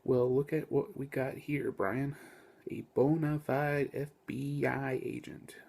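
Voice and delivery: slow, half-whispered Southern drawl; Southern accent. Acted. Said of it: "southern accents".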